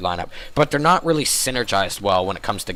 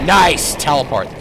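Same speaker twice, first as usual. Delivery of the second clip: excited; 4 semitones higher, 6 dB louder, slower than usual. Real, not acted. Caster praises a play.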